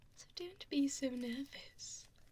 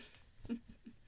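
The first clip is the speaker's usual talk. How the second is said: soft evil chuckle; 5 dB quieter than usual. Acted. Villain gloating over captive.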